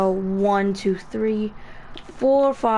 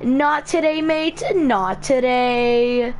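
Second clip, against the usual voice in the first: higher, slow, stretched catchphrase with "mate"; YouTuber impression; 3 semitones higher, 3 dB louder, slower than usual. Mimicking the Home of Games guy, hammed-up higher voice. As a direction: ham up a British YouTuber's line. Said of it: "talking like that guy who goes".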